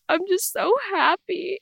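high, emotional retold voice; happy crying. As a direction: act overcome with happiness. Retold tearful happiness to dad.

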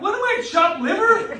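high, mock-offended complaint; wounded indignation. Husband retells feeling passed over for her dad, high and mock-hurt. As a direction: play the slighted husband. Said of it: "And I'm like".

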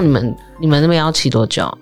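ordinary delivery

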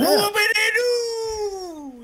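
drawn-out high mock whoop; sarcastic cheer. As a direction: stretch it out like a fake celebration.

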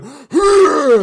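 loud, high effort burst; huge mock exhale as the weight drops; weightlifter's exertion noise. Shown as a demonstration. Showing how a deadlift record should end, loud effort noise. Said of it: "drop it and go".

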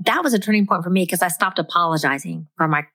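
usual speaking voice

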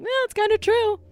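high mock voice of the son; squeaky put-on defence of the show; high voiced imitation of a royal son; imitating Prince Harry. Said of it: "and he's like".

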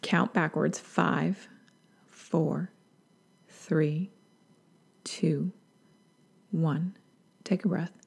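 hushed guide into a breath; calming countdown. Shown as a demonstration. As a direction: count down quietly with long pauses. Demonstrates pausing to count and breathe.

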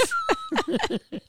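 a very high squeal of laughter. Real, not acted. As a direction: let a squeal of giggles out. Laughing about borrowed buttons.

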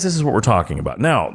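ordinary talk, nothing marked

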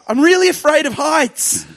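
very high, loud comic wail; acted panic. Visitor confessing his fear to the receptionist, hammed-up high panicked cry. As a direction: blurt it out in exaggerated panic.

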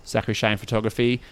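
normal talking voice

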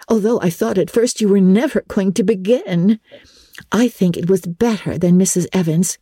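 put-on a girl dreaming aloud of riches; Jane.

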